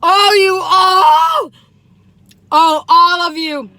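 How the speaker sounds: slow, loud, high ecstatic cry; ecstatic exclaim. Real, not acted. She calls out to her viewers, loud and ecstatic. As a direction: stretch out the joy, loud.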